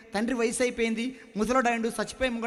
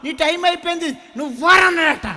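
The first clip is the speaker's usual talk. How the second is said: gold refusing scornfully; 7 semitones higher, 9 dB louder than usual. Acted. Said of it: "అప్పుడు బంగారం అంటుందంట".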